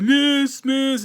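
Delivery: high, whiny mock tattletale calling miss; tattling kid. Snitch kid calls the teacher, high mock whine.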